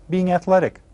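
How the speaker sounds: usual speaking voice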